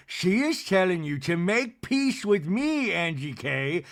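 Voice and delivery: slow, put-on housewife voice; Meredith. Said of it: "Meredith jumps in for Lisa".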